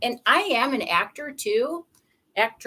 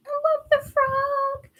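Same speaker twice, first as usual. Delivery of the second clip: gushing; slower than usual. Real, not acted. Gushing over frog card.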